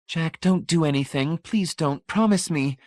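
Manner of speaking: high, urgent begging; wife begging husband not to act. A narrator's reading. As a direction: plead with rising fear.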